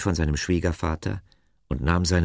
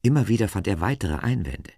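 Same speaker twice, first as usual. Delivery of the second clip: usual speaking voice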